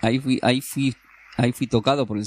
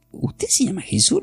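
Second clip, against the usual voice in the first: a light mock of the amazed host, much higher; astonished host; 9 semitones higher than usual.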